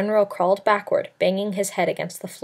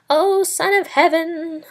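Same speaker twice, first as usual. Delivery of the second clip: high, slow screech of a fawning soothsayer; a wheedling court soothsayer's high, slow cry; 9 semitones higher, slower than usual. A narrator's reading. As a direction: stretch the address out in a high screech. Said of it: "the fellow screeched".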